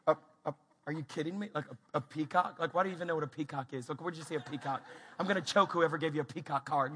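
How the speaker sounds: low, quick stammered disbelief; incredulous muttering. Acted. Dad thrown by toddler's peacock question, incredulous. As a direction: fumble the start, then grumble fast.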